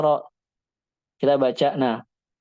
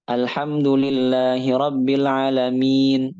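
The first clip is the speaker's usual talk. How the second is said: Quran recitation; slower than usual. Shown as a demonstration. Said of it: "reciting a Quran verse".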